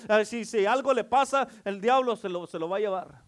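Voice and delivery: loud, driving sermon with rising peaks; fervent preaching. Acted. Preacher drives the prodigal son message home, loud and fervent. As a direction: preach it to the back row.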